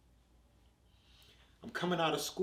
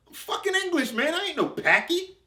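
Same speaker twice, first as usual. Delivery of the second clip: much higher and louder, outraged protest; indignant shout; 9 dB louder than usual. Retold or imitated. To the shouting kids, a loud high indignant protest. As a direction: yell it back, offended.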